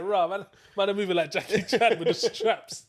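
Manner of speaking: loud, hyped boast; hyped-up action hero. Retold or imitated. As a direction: brag like a kung fu hero. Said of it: "It's like".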